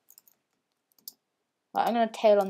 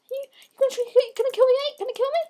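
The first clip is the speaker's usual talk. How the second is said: high mocking taunt; mocking squeal; 4 dB louder, much faster than usual. Real, not acted. Mocking an attacker in game.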